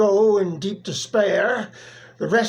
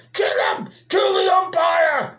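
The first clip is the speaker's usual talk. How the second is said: angry fan's shout; 9 semitones higher than usual. Acted. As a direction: yell it at the umpire from the bleachers. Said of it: "shouted someone on the stand".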